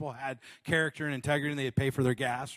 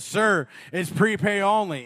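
loudspeaker announcement; 3 semitones higher, 8 dB louder than usual. Retold or imitated.

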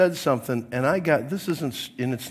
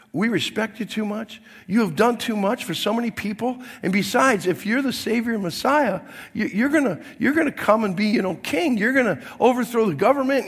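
raised, pleading objection to the Lord; earnest protest; 6 semitones higher than usual. Retold or imitated. Peter begging Jesus to drop his plan, raised and pleading. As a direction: plead with him not to go through with it.

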